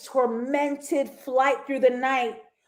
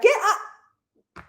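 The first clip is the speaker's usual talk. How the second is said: put-on Australian accent; 9 semitones higher, 3 dB louder than usual. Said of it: "that little Australian accent".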